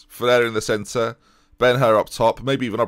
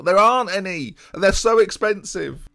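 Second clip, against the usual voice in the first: high-pitched, frustrated complaint; exasperated protest; 10 semitones higher, 3 dB louder than usual. Real, not acted. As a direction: push back, voice raised in pitch with frustration. Protesting to imagined viewers' advice.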